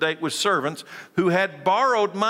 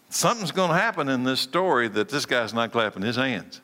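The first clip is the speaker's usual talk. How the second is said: usual speaking voice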